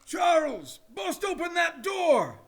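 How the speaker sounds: distant shouted command from outside the door; shouted order. Acted. Father outside ordering Charles to break the door, shouting. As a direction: bark the order through the door.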